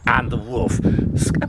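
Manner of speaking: wrong voice. Shown as a demonstration. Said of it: "wrong voice".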